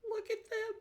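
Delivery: tiny, very high squeaky coo; cute-gushing squeal. Real, not acted. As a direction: squeal over something adorable. Streamer gushing over cute game creatures.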